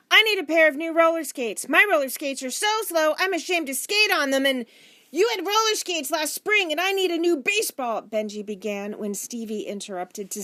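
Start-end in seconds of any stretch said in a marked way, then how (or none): 0.1-8.0 put-on high, loud kids talking over each other; insistent, raised-voice child demands; children clamouring. A narrator's reading. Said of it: "the talk became louder and louder".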